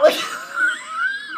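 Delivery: high squealing laugh, real; shrieking laugh. Real, not acted. Cracking up after scare.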